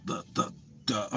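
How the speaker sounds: ordinary talk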